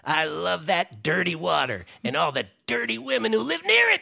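high, leering Quagmire voice ending in giggity. Retold or imitated. Writer pitches a Quagmire joke to Seth, leering and high. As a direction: slide way up into giggity. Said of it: "as Quagmire".

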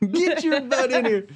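high, slow Southern mom order; Southern accent. Acted. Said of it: "The Southern accent seems to be".

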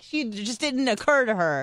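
normal talking voice

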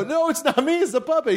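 high, hurried excuse; comic denial. Retold or imitated. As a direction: blame the puppet, hammed up. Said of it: "I go".

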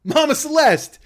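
loud, high startled interruption; mock alarmed shout. Host interrupts Mama Celeste, loud alarmed shout. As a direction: stop her mid-rant. Cutting off racist pizza mascot.

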